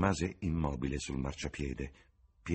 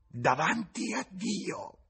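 slow, much higher, louder oath; old woman's cry; 7 semitones higher, 4 dB louder, slower than usual. A narrator's reading. Tavern woman cries out her oath to James. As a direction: swear it to the heavens. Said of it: "gridò".

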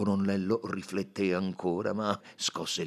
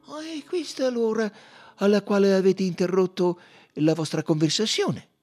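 Poirot; 13 semitones higher, 10 dB louder than usual. Acted. Poirot questions the colonel.

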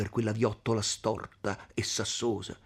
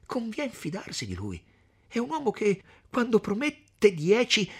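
higher, reassuring woman's voice; high earnest young woman; 12 semitones higher, 5 dB louder than usual. Acted. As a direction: plead for trust in him, pitched up. Lucia voiced in story.

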